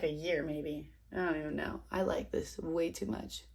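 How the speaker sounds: usual speaking voice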